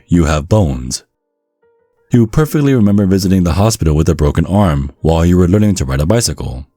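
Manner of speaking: usual speaking voice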